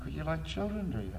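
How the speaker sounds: teasing flirt. Retold or imitated. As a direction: tease her knowingly. Flirting with divorced woman.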